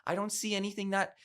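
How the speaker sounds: high, disbelieving why, repeated; incredulous. Real, not acted. Podcast host baffled by the Best Picture winner, incredulous.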